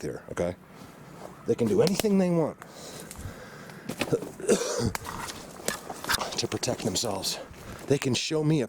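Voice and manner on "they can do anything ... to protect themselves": intense. Real, not acted. Intense claim about creatures.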